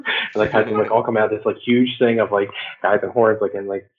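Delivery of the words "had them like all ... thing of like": ordinary talk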